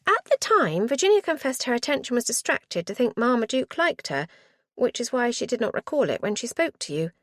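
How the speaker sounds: put-on high, bright eager girl voice; eager young niece. A narrator's reading. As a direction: play the keen niece, rushing.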